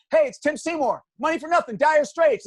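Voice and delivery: his own call played back on air; excited caller. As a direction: blurt the titles out again. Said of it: "it's like".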